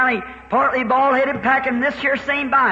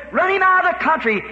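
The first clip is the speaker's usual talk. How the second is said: mother's angry plea; 6 semitones higher than usual. Retold or imitated. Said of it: "She was honest about it".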